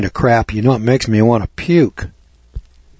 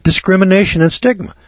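"Discrimination and stigma" echoed much higher, incredulous; scornful repeat; 9 semitones higher than usual. Retold or imitated. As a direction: echo the phrase back with scorn. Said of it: "Oh my God".

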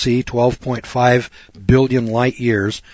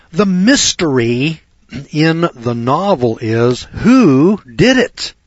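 slow and loud, "who did it" drawn out; emphatic spelling-out; 4 semitones higher, 5 dB louder, much slower than usual. Real, not acted. Preacher explaining mystery novels to listeners, slow and emphatic. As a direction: hammer "who" high and long.

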